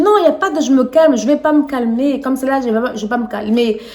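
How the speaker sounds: loud, fast angry refusal; angry patient demanding the manager; losing it. Retold or imitated. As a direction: refuse to calm down, demand the boss. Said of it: "j'ai pété un câble".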